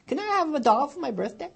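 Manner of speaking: put-on high, hopeful childlike plea; little girl's prayer. Little girl asking God for a birthday doll, high. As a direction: ask God sweetly like a small child. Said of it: "she turned to God and said".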